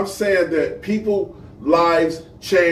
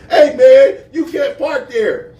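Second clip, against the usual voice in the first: loud, high mock call-out, slowing on the second line with a laugh; hammed-up stranger shouting after him in a parking lot; mock scolding guy; 8 semitones higher, 6 dB louder, slower than usual. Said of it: "This guy comes behind me and say".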